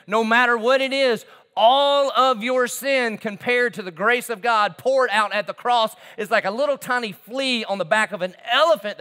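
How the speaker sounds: loud, high-pitched preacher crescendo; impassioned preaching. Real, not acted. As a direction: drive it home at full preaching force. Preaches at full force.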